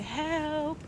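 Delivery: high, thin mock plea for help; hammed-up plea for help to passing police; mock cry for help. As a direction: beg the police in a fake panic. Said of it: "urge to be like".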